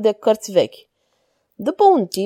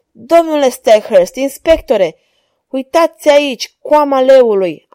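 loud, raised call of discovery; joyful exclamation; 3 semitones higher, 6 dB louder than usual. A narrator's reading. Holmes shows his companions the lion's mane, excited exclamation. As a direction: call the others over excitedly. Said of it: "am exclamat".

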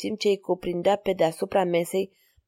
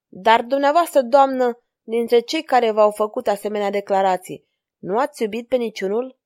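ordinary delivery, nothing marked

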